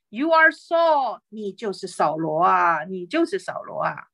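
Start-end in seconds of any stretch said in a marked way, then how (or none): 0.1-1.2 woman unmasks Saul, high and slow; high, slow accusing cry. Acted. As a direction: fling the name at him in a raised voice. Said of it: "高声的喊叫".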